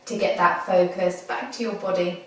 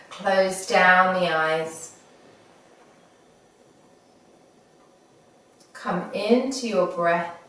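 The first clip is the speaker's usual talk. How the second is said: guided meditation. Acted. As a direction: ease them gently into the breath. Leads a body scan meditation.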